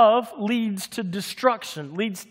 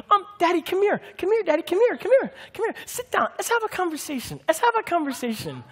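put-on much higher, sweet toddler voice; cute little girl voice; 11 semitones higher, faster than usual. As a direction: coax like a two-year-old asking Daddy over. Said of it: "a little cute little voice".

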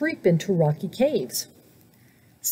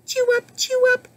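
very high, peppy chirp; chirping cricket. A narrator's reading. Cricket popping up to join the band, high chirp. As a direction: chirp it in a tiny squeaky voice. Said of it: "chirped Cricket".

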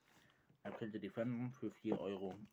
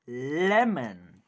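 loud announcement; 13 dB louder than usual. Real, not acted. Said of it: "Ja, Lemon".

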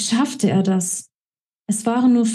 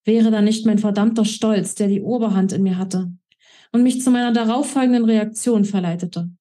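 ordinary delivery

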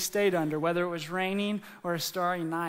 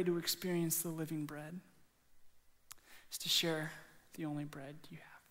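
soft, measured preaching with long pauses; hushed sermon close; 8 dB quieter than usual. Real, not acted. Preacher closing and dismissing the congregation, hushed and earnest. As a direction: bring it down quiet and solemn.

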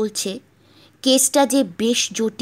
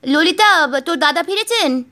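higher, louder woman's voice; wife's voice; 7 semitones higher, 7 dB louder than usual. Acted. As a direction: call out as the anxious wife. Wife calls on phone.

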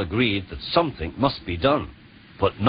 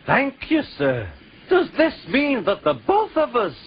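delighted; 9 semitones higher than usual. Acted. Twins delighted at staying.

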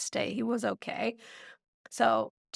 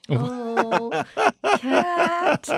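exasperated laugh; 2 semitones higher, 4 dB louder than usual. Retold or imitated. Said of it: "And I'm like".

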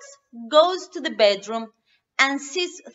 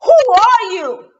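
louder gruff question; Daddy Bear; 6 semitones higher, 15 dB louder than usual. Acted. Daddy Bear challenges Goldilocks, loud. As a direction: boom the question. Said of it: "Daddy Bear asks".

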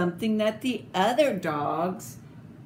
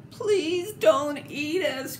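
higher, slow frightened begging; scared plea; 8 semitones higher, slower than usual. A narrator's reading. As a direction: plead with the monster. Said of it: "cried the dogs".

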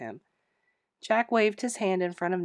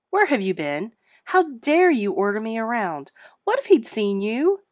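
louder, higher, furious demands; angry scolding; 4 semitones higher, 8 dB louder than usual. Acted. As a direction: tear into him for meddling. Scolding the ghost after slamming door.

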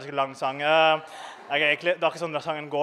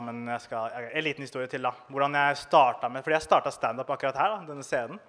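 ordinary talk, nothing marked

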